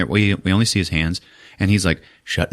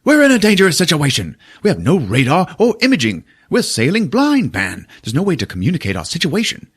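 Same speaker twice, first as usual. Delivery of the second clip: captain yelling; 9 semitones higher than usual. Retold or imitated. Retelling the captain silencing the crew, mock yell. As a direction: bark orders like a panicked captain. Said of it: "The captain's like".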